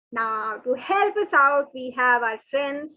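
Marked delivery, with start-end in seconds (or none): none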